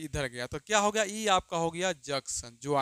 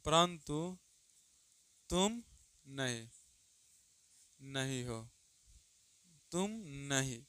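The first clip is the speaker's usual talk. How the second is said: softer, word by word; slow, soft dictation; 2 semitones lower, 4 dB quieter, much slower than usual. Shown as a demonstration. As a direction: let the students write each word. Dictates example sentence. (धीमी आवाज़ में शब्द-शब्द लिखवाना)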